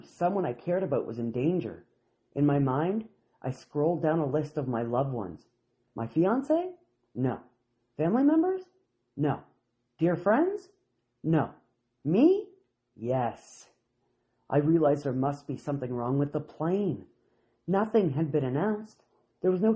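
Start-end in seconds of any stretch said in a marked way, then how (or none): 5.9-13.7 rising questions. Retold or imitated. Checking loved ones in mind.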